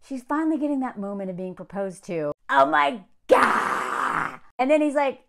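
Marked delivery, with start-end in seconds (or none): 2.5-4.4 very slow, stretched-out awe; drawn-out gasp. Real, not acted. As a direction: let it hang like you can't believe it. Reacting to the proposal moment.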